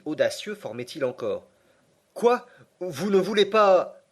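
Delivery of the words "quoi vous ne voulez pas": high, loud disbelief; stunned outburst at the refusal; shocked. Acted. Nephew shocked by uncle's refusal.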